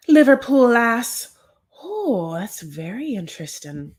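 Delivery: put-on a clumsy American stab at a Liverpool accent; bad Liverpool accent. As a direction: try a Liverpool accent and miss toward Irish. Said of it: "my American horrible version of this".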